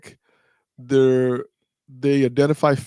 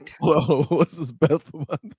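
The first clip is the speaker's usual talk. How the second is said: laughing; slower than usual. Real, not acted. Teasing co-host about her lisp.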